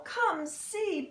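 ordinary delivery, nothing marked

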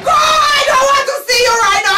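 high, loud, tearful dismissal; queen sending the servant away, angry tearful shout; angry crying shout. Acted.

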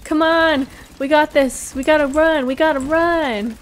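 loud, high urgent calls; rally the team to run; urgent shouting. Real, not acted. Urging team to flee.

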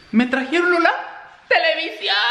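high-pitched, thrilled announcement; excited squeal. Real, not acted. Vlogger telling viewers what was delivered, excited squeal. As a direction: squeal the big news in a high voice. Breaking surprise delivery news.

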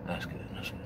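near-whispered, barely there; hushed murmur. Retold or imitated. Man in bed coaxing himself to sleep, hushed. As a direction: murmur it like you're almost asleep. Self-talk settling in bed.

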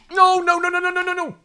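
very high string of nos; panicked falling wail. Real, not acted. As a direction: squeal it on one high held pitch. Climber falling.

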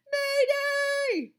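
very high, loud distress call; crying out for help. A narrator's reading. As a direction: shriek the emergency call. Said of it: "cried Missus Shorthand".

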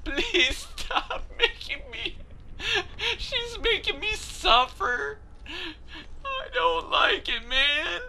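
high, whiny fake crying; mock sobbing. Player begging the game to stop, mock sobbing. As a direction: whimper it like a hammed-up sob.

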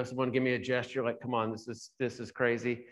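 ordinary delivery

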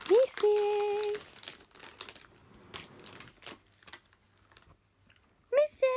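calling out. Real, not acted. Calling the hamster.

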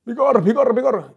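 very high, loud, frantic wail. Retold or imitated. Celebrity wailing to the teller about his stolen cap, very high and loud.

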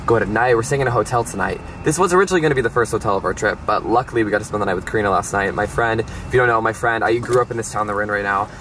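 usual speaking voice

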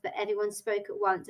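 usual speaking voice

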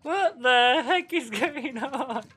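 high, loud startled outburst; real flabbergasted yelp; shocked disbelief. Real, not acted. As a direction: squeal it in baffled shock, voice leaping up. Startled by gifted subs alert.

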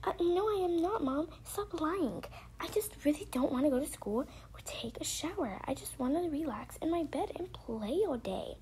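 high-pitched whiny daughter voice; protesting child. Pretend. Daughter argues with mom about school and shower, protesting. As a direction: play a kid denying a tantrum.

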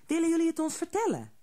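fast, high, eager rush; quickly. A narrator's reading. Hare hurries to ask the troll, fast eager question. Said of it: "zei Haasje snel".

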